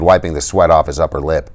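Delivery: plain reading voice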